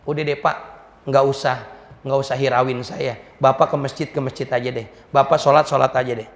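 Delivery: put-on low, downcast child's refusal; dejected brush-off. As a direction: wave the man away wearily, pitched low. Crying child brushes off questioner. (menolak lesu dengan nada rendah)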